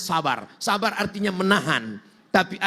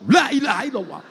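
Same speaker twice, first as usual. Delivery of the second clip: raised, sudden burst of zikir; mock-angry zikir; 4 semitones higher than usual. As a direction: blurt it out as a hammed-up retort. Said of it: "lalu kita marah, lalu kita buat".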